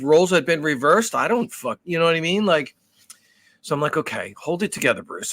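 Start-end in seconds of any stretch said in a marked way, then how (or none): 4.0-5.3 low, quieter self-talk; steady yourself under your breath; holding it together. Retold or imitated. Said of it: "willpower to just hold it together".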